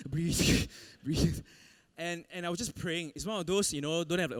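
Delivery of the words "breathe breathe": laughing breathe. Retold or imitated. Mocking advice to breathe underwater.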